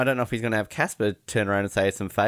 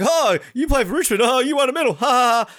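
high, loud mocking interviewer; 11 semitones higher, 5 dB louder than usual. Mocking the interviewer's forced chat, high fake laugh. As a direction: send up the fake enthusiasm. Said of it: "it was just like".

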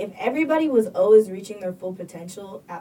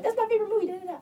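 gushing fan voice; 12 semitones higher, 3 dB quieter, much faster than usual. Retold or imitated. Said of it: "Everybody be like".

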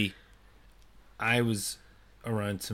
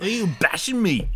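high, loud mock kid; twelve-year-old; 9 semitones higher, 6 dB louder than usual. Mocking the word bashed, imitated twelve-year-old. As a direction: whine it like a schoolkid, exaggerated. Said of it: "sounds like something a twelve-year-old go".

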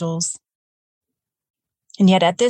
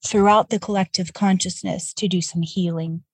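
usual speaking voice

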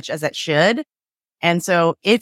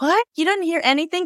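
imitating a stunned spouse, pitch jumping higher; mock incredulous, going higher on anything; shocked disbelief; 10 semitones higher than usual. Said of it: "the person who was speaking is shocked".

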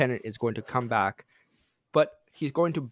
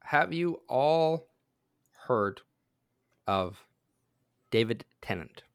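slow, drawn out suspense; dramatic slow reveal; slower than usual. Shown as a demonstration. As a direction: drag it out for suspense. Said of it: "dramatic slow build-up".

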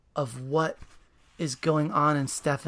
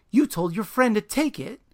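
loud, higher accusing line; accusation; 7 semitones higher, 5 dB louder than usual. Acted. Super blaming Stefan for the theft, angry. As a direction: hurl the accusation.

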